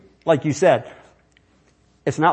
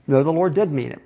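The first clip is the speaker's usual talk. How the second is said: usual speaking voice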